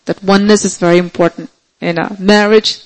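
usual speaking voice

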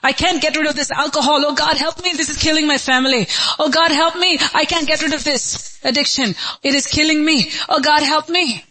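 high, urgent cry for God's help; crying out desperately. Acted. Preacher voicing addicts who cry to God for help, desperate plea. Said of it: "They're crying every day and they're saying".

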